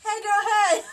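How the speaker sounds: high, loud sassy shout; sassy shouted greeting. Pretend. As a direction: yell hey girl with flair.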